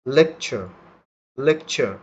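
লেকচার with no final r, like lekcho; native pronunciation. Shown as a demonstration. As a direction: drop the r in লেকচার. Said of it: "তাহলে কিভাবে আমি উচ্চারণ করলাম".

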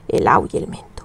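low whisper to herself; whispered counting. Real, not acted. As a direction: breathe it to yourself. Counting stitches under breath.

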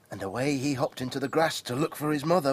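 usual speaking voice

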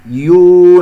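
loud, held يو with fully rounded u; rounded lips. Shown as a demonstration. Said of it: "نضم الشفتين". (ضم الشفتين في يو)

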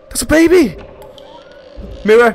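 high, loud alarmed cry; real fright calling out; frightened cry. Real, not acted. Baby in the mirror scare.

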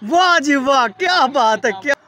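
ordinary delivery

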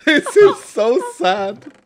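slow high laughing lament; mock sad, laughing. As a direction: wail it through laughter.

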